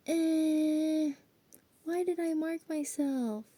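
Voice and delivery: high-pitched put-on whine of regret; hammed-up high inner voice; mock regret. Acts out own regret over tattoos.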